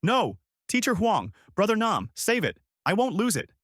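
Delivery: high-pitched, understated scream; restrained scream. A narrator's reading. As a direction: cry out high, holding back the volume.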